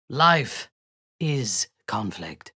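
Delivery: ordinary delivery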